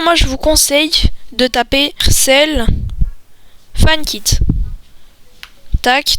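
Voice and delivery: usual speaking voice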